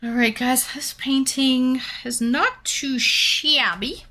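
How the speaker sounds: usual speaking voice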